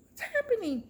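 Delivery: high, frightened squeal; acted panic. Retells panicking at the sight.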